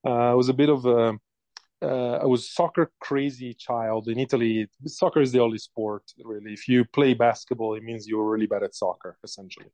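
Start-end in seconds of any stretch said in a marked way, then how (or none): none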